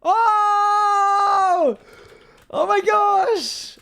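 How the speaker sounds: high, loud stunned shout; shocked shout. Real, not acted. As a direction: yell out in disbelief. Big win reaction.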